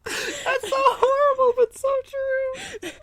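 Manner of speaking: high squeaky laugh-talk; laughing so hard the voice cracks. Real, not acted. Laughing at friend's prediction.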